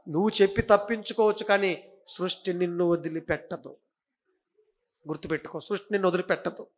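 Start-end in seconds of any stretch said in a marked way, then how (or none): none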